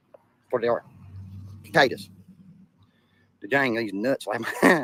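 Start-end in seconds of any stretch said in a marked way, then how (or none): 1.6-2.1 "Cicadas" with a hard K start, "kuh-kay-das"; own mispronunciation. Shown as a demonstration. To mess with people, "Cicadas" as "kuh-kay-das". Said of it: "keep on saying it like Dagburn says it".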